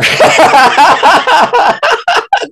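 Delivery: long high burst of laughter; long loud laugh. Real, not acted. Laughing at his own nervous small talk.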